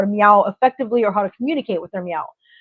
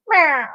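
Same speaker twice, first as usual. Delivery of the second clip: a short, high, strangled meow; weird cat meow; 5 semitones higher than usual. Retold or imitated. Owner imitating her cat's odd meow, high and clipped. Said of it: "she kinda goes".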